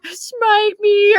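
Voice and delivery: high but subdued cartoon voice; high-pitched, toned-down cartoon impersonation; sedated Carl Wheezer. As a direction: put on a high, sedated character voice. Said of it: "TikTok loves him to be like".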